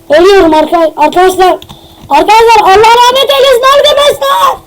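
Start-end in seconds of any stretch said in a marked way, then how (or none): 2.1-4.6 high-pitched hammed-up farewell wail; mock lament. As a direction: wail it, climbing to a strained high cry.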